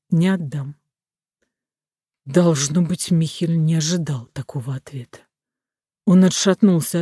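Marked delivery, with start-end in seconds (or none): 0.1-0.7 quiet resolve; firm refusal. A narrator's reading. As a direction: refuse firmly without raising the voice. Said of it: "твёрдо сказал".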